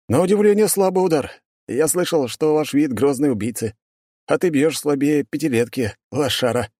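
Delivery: high, cocky taunting voice; mocking taunt. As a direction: sneer at the demon. Hero taunts demon.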